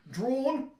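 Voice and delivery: loud, high grand naming; announcing stage player. Acted. Performer points to Drew for the audience, loud announcement. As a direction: proclaim the name grandly. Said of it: "points to Drew and goes".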